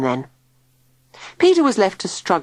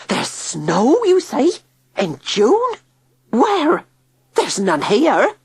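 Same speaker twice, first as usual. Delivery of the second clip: incredulous; 9 semitones higher, slower than usual. A narrator's reading. Tilly disbelieving.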